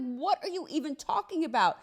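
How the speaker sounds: high-pitched, incredulous retort; indignant disbelief. Retold or imitated. Wife answering husband's accusation, indignant. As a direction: throw it back in disbelief.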